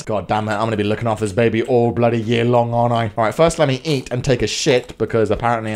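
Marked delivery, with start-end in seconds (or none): none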